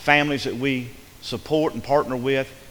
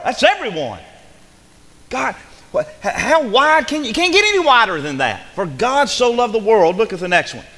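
loud, high, hammering each "not"; full-force preaching; 7 semitones higher, 7 dB louder than usual. Acted. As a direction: preach it out, louder each line.

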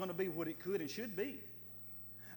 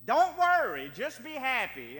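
mocking catchphrase; 4 semitones higher, 9 dB louder than usual. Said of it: "some kind of emotional feeling".

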